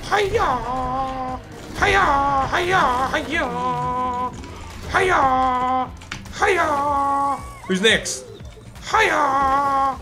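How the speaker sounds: high shouted martial arts yells; karate cries. Pretend. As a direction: kiai with every strike. Repeated karate attack cries.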